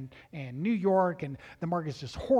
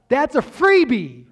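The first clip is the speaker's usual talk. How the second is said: excited; 9 semitones higher, 14 dB louder than usual. Real, not acted. Preacher excited about visitors.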